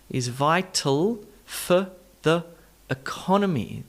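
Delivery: slow, separated "for the" with uh vowels; schwa demonstration. Shown as a demonstration. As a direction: isolate reduced "for", "the", then stress CON in economy. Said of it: "add in the all-important schwa".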